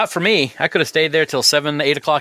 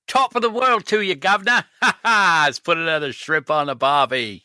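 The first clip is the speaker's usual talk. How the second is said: hammed-up mix of English and Australian, starting high; badly faked Cockney-meets-Aussie greeting, with a jokey laugh midway; horrible English-Australian accent; 3 semitones higher than usual. Co-host greets podcast partner in a horrible English-Australian accent. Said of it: "horrible, English and, and Australian accents".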